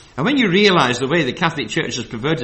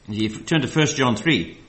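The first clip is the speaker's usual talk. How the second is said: usual speaking voice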